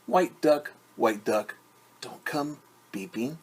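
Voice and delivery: ordinary delivery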